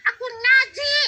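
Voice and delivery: put-on a child tattling on mom; whining little girl. Child Cici whines. (anak kecil mengadu merengek)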